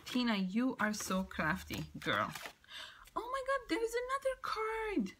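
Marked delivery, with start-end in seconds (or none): 3.2-5.1 much higher, thrilled; excited squeal. Real, not acted. Thrilled to find another card, high squeal. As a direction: shoot up high with delight at a surprise.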